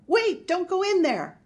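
loud, high warning shout; alarmed yell, pitched high; urgent yell. A narrator's reading. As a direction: call after someone to stop them. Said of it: "yelled Marge, running after him".

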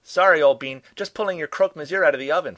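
high, loud cheery holler; yelling back. A narrator's reading. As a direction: yell it back from the kitchen. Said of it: "Furman yelled back".

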